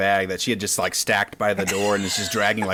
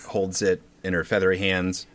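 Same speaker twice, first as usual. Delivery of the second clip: ordinary delivery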